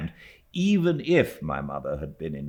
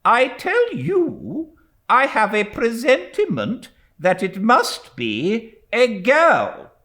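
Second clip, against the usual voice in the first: slow, louder, emphatic repeat; insist, leaving no room; insistent; 10 dB louder, slower than usual. A narrator's reading. Stern aunt insisting to the doubting widow, slow emphatic.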